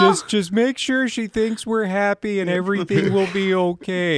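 high-pitched jokey pretend reassurance; mock nervous reassurance. Acting out frightened band members' thoughts.

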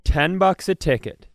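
usual speaking voice

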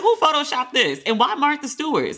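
high-pitched retold bafflement; incredulous reaction. Retelling her disbelief at the cover.

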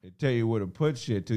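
usual speaking voice

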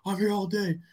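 high mock taunt, repeated; imitating a fired-up quarterback chant; Mahomes yelling in his face. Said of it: "he's like".

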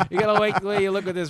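hammed-up announcer laughing; laughing mock tagline. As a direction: spoof the ad slogan through laughter.

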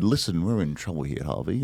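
soft, drowsy Louis Litt voice; sleepy Louis Litt. Pretend. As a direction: murmur it like a sleepy narrator. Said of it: "Lewis Litt said".

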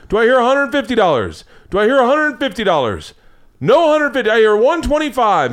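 hammed-up auctioneer. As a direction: call the bids like an auctioneer. Auctioneer calling bids.

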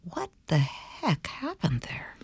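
hushed, breathy whisper of disbelief; soft whispered question to the guest about falling ill. Real, not acted. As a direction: drop to a whisper, leaning in. Host probes illness story.

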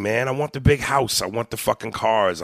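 ordinary talk, nothing marked

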